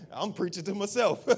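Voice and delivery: said through a chuckle; laughing. Real, not acted. Pastor joking to the congregation, laughing lightly. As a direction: laugh while you admit it.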